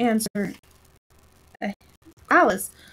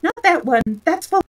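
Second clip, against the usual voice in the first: hurried; 8 semitones higher, 4 dB louder than usual. Acted. King hurrying the messenger.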